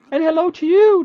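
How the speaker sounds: high, loud chirpy fairy greeting; a tiny creature cheerfully says hello. Acted. Tiny fairy creature greets the traveler in a high cheerful voice. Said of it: "the creature replies cheerfully".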